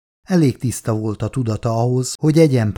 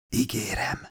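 much softer, barely voiced promise; whispering a promise to the dying girl; whispered promise; 2 semitones lower, 10 dB quieter than usual. A narrator's reading. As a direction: breathe the promise out.

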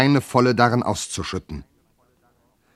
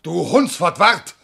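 door quarrel shouting; 8 semitones higher than usual. Acted. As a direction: bellow through the locked door.

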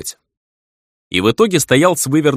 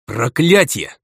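frustrated outburst, higher and sharp; angry curse; 5 semitones higher, slower than usual. Acted. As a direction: spit out the curse. Hero curses, punching the wall.

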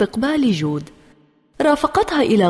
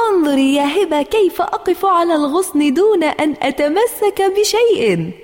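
put-on high, excited child's boast; excited, lifted bragging voice; showing off; 8 semitones higher than usual. A narrator's reading. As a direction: call out proudly from up high. Child shows off on a branch.

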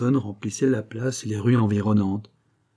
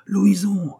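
much higher, louder call; 10 semitones higher, 5 dB louder than usual. A narrator's reading. Courcoran turning to call his tiger, high loud cry. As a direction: call the tiger from far away. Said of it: "cria trois fois".

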